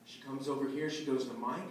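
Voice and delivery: ordinary talk, nothing marked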